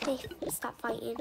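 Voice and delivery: ordinary talk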